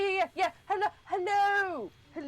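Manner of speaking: high, eager repeated hellos; excited gushing. Acted. Aisling greeting the walrus with high excitement. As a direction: gush hello at everyone.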